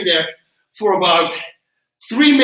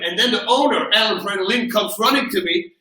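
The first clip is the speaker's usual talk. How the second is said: ordinary talk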